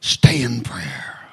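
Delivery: hushed, slow whisper; urgent whisper. Real, not acted. Preacher whispering to the congregation to pray. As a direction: breathe the words out slowly.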